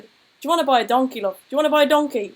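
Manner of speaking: imitating an Irish traveller bloke hawking a donkey; fast loud Irish traveller sales pitch; Irish traveller accent. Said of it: "the traveller accent comes into my head".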